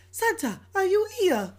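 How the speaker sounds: high-voiced child with a rough French accent; imitated French child's voice. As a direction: do a little French kid, pitched well up. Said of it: "I think he's in France".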